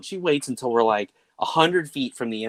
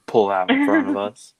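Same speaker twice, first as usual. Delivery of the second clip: put-on kid's voice; 10 semitones higher, 4 dB louder than usual. Son quoting new phrase.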